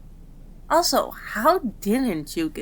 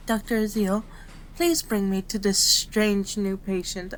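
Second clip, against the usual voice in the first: usual speaking voice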